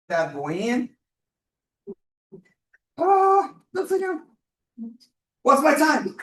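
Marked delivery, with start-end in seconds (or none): 3.0-6.2 high, loud, breathless rush; racing against the clock; excited. Real, not acted. Racing the clock, finishing build.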